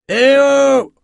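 long, high hailing call; loud high holler; lookout's shout. A narrator's reading. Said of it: "cria soudain la vigie du cargo".

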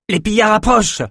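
high-pitched, urgent call of alarm; warning cry. A narrator's reading. Captain alerting the sailors to approaching raiders, high urgent cry. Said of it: "cria Ganli".

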